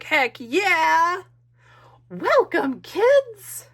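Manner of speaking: high, loud cheer as a thrilled lady; hammed-up, booming high welcome; acted excitement. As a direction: whoop it out in an excited character voice. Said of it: "She's so excited".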